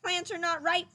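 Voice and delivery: a lightly voiced fussy rabbit; mild rabbit lecture; Rabbit lecturing. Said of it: "he told his plants".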